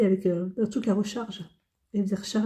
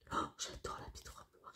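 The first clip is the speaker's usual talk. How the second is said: breathy whispered aside, barely voiced; 20 dB quieter than usual. Real, not acted. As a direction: lean in and whisper it like a secret. Whispered aside about perfume. (chuchoté)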